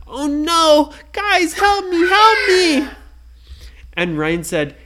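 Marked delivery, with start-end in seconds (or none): 0.1-2.9 much higher, louder cry for help; panicked plea. Acted. Brady squished by cheese, crying for help. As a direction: beg them, squished.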